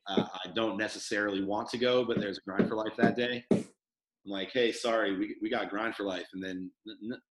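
usual speaking voice

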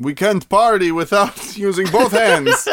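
laughing mock Scandinavian accent. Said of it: "That's my Scandinavian accent".

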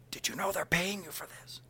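high, soft stage whisper; astonished whisper. Retold or imitated. Dad secretly astonished about pay.

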